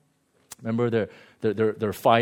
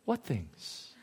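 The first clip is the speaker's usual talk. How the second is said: much softer, mild question; gentle innocent ask; 12 dB quieter than usual. Retold or imitated. Jesus softly asking the disciples what things. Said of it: "he goes".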